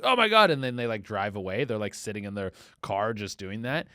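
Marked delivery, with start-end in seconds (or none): 0.0-0.5 startled. Retold or imitated. Caught husband sees camera.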